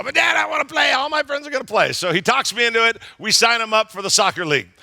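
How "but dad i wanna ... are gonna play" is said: put-on high, whiny kid's plea; pleading little boy. Son begging his dad to let him play soccer, high whiny plea. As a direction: beg like a seven-year-old who wants to join his friends.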